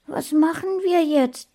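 hohe Kinderstimme, fragend. A narrator's reading. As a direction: frag wie ein kleiner Junge. Kind fragt. (hohe, fragende Kinderstimme)

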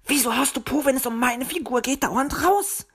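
high, huffy little bird voice; indignant challenge. A narrator's reading. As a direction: demand an answer, high and offended. Bird quarrels with friend. (hoch und empört)

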